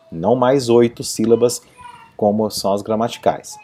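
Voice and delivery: normal talking voice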